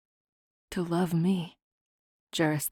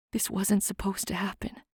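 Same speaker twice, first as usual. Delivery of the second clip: faint, much softer voice; weak voice; 2 semitones higher, 8 dB quieter than usual. A narrator's reading. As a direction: barely get it out, weakly. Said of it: "in a too weak a voice".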